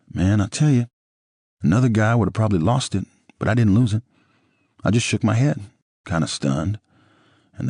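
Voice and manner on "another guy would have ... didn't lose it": ordinary delivery, nothing marked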